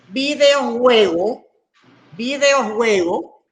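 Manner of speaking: very slow, drawn-out dictation; dictating. Shown as a demonstration. Teacher setting students a compound word, dictating slowly. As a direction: stretch the word out, rising at the end. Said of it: "Si les pongo la palabra".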